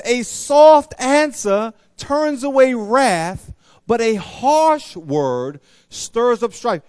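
slow, loud scripture reading; slow emphatic verse. Acted. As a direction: proclaim the proverb slowly. Preacher reads verse slowly.

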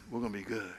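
soft, low promise; calm reassurance. Retold or imitated. God calming the preacher before he speaks, soft and low. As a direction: settle him down gently. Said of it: "He says".

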